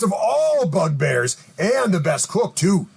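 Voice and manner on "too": normal talking voice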